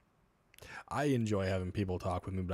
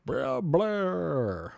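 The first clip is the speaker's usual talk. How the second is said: high, loud garbled babble; garbled spirit box; 7 semitones higher, 9 dB louder than usual. Retold or imitated. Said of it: "it sounds like".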